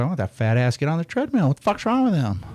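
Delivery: incredulous disdain, high-pitched; mock judgmental outburst. Mimics his old judgmental reaction.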